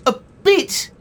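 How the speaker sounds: very high, loud squawk; outraged disbelief. Acted. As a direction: echo it back incredulously. Outraged at friend's understatement.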